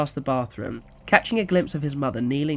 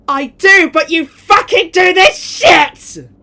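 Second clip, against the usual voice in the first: slow, much higher and louder fury; angry shout; 16 dB louder, slower than usual. Acted. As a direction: snap back in rage. Mother shouting back.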